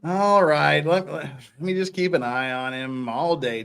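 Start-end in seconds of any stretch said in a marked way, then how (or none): none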